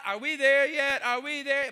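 loud, nagging are-we-there-yet; whiny kids in the car. Retold or imitated. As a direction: play the restless kids in the back seat. Kids whining on family trip.

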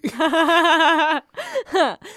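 loud, high burst of laughter; long laugh. Real, not acted. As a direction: crack up at a friend's joke. Reacts to co-host's joke.

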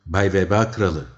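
loud bold address. A narrator's reading. Sailor hails the plague king, loudly. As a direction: call it out loudly.

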